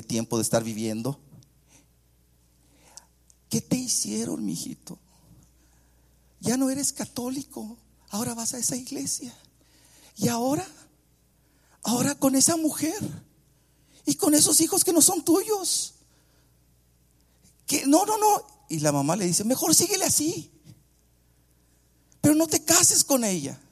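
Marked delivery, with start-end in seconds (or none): 3.5-18.5 high-pitched mock-distraught mother; upset mother. Mother protesting her son's wedding, upset and high-pitched. 19.4-23.6 high-pitched imploring mother; objecting mother. Retold or imitated. Mother begging son not to marry, high-pitched protest.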